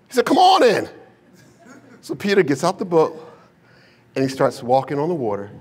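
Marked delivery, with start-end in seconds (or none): 0.3-0.9 high, loud acted holler; calling out. Jesus calls Peter out of the boat, calling out. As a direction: holler an invitation across the water.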